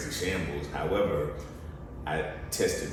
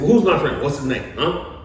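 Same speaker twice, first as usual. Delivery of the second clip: higher, louder rapid-fire questioning; lightly acted, insistent demand for an answer; Denzel Washington; 8 semitones higher, 9 dB louder than usual. Denzel Washington grilling a young actor at the gym, mock insistent. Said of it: "And he said".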